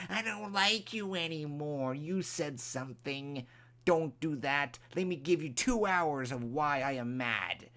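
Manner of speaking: lightly lampoon a petty rant; mock angry ranter. Mocking a commenter who rants for hours, lightly whiny. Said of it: "people yelling and screaming".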